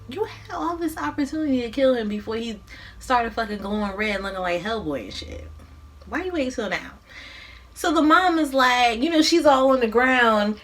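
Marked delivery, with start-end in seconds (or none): none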